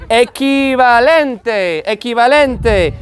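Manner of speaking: equivalente slowly as "eh-kee-va-len-tay"; drawn-out Spanish equivalente with a long "ee". Shown as a demonstration. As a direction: model equivalente syllable by syllable, very slowly. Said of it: "Q U is like a K".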